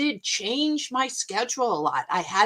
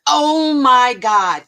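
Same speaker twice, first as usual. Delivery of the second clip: loud, very slow, drawn-out gasp of horror; shocked; 4 semitones higher, 10 dB louder, much slower than usual. Retold or imitated. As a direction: recoil from what you just saw. Finding a bed bug.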